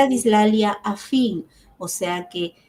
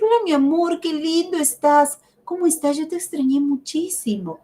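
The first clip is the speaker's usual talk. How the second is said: much higher, sweet and tender; sweetened voice; 8 semitones higher than usual. Shown as a demonstration. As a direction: sweeten the voice for a child without distorting sounds. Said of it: "Estoy endulzando mi voz". (voz endulzada y tierna, mucho más aguda)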